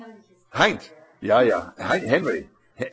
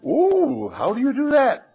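high, wide-eyed ooh; amazed; 11 semitones higher than usual. Acted. Classmate marveling at the Japanese boy, high and amazed. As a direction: gasp it out in wonder.

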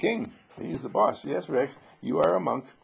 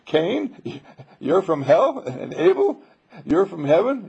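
higher, louder mock divine pronouncement; a hint of a grand God voice, higher and louder; mock God proclaiming; 6 semitones higher, 7 dB louder, slower than usual. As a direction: boom out the labels like a playful God. God labelling Cain and Abel.

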